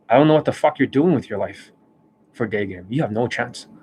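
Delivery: ordinary talk, nothing marked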